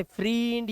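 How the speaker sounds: "फ्रेंड" mispronounced as "freend", long ee; wrong long-ee vowel. Shown as a demonstration. Teacher shows learners what not to say, "फ्रेंड" as "freend". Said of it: "नहीं बोलते sir, फ्रेंड बोलते हैं".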